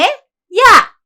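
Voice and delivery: sudden high, loud yell; furious shout. Acted. Mother-in-law yells at the daughter-in-law for not replying, loud furious shout. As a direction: bark the one word out at full force.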